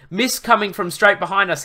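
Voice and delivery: alarmed. A narrator's reading. Luke warns the rider of incoming mist, alarmed. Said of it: "he said alarmingly".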